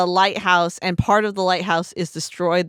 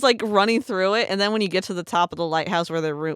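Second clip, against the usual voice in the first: usual speaking voice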